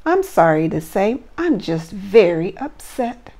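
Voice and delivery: lively rhyming storybook read; reading to children. Acted. Teacher reading a Christmas story to her class, lively storybook voice. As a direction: read the verse aloud with bounce for little ones.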